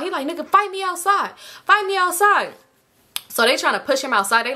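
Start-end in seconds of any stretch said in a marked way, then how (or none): 0.3-2.5 high, heated dare; hammed-up high-pitched challenge; mock fight challenge. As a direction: imitate a worked-up man calling someone out.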